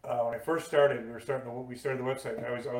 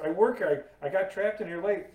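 retold protest; 6 semitones higher, 3 dB louder than usual. Explaining himself to sheriff, retold.

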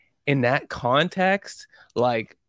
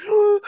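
sharp gasping oh, pitched way up; mock gasp; 9 dB louder than usual. Retelling the sister's tearful scene, a high gasped oh. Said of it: "she's crying".

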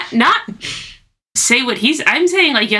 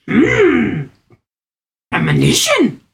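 Yoda voice; 3 semitones higher, slower than usual. Retold or imitated. Yoda impression about premonition.

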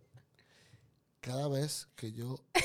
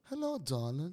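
put-on English accent; 4 dB quieter than usual. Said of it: "El acento inglés en la mujer".

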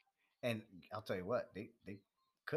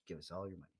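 cult leader whisper; 4 semitones lower, 6 dB quieter, faster than usual. Pretend. Joking about a money cult.

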